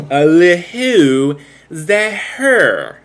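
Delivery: slow, loud, high drawn-out quote; hammed-up Ace Ventura line; movie quote impression. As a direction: stretch each word like a cartoon. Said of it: "Type in where that one's from".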